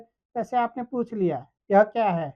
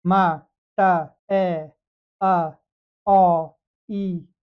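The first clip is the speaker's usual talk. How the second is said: the row read in reverse, loud, with pauses; backwards reading; 3 semitones lower, 5 dB louder, much slower than usual. Shown as a demonstration. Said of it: "जैसे back counting होती है वैसे". (उल्टी गिनती की तरह ध्वनि पढ़ना)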